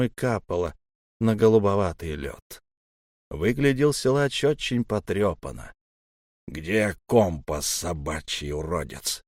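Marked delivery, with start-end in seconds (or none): 6.5-9.2 low slow menacing growl. Acted. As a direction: growl it slowly, threatening. Monster Elm threatens narrator.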